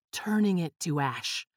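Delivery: ordinary delivery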